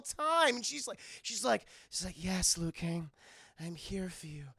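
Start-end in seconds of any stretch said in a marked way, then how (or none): 2.2-4.4 soft, low, breathy temptress; lightly voiced seductress, quiet; sultry Jade. Jade rubbing up on Liu Kang, soft sultry voice.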